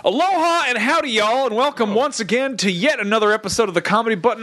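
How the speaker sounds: bright, high host welcome; show host intro. Acted. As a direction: open the show with gusto. Show opening.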